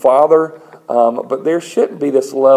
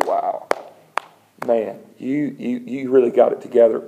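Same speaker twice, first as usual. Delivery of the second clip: soft, low, awed praise; hushed admiration; 3 semitones lower, 6 dB quieter than usual. Acted. Onlooker praising someone's public prayer, hushed admiration. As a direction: murmur it as an impressed onlooker.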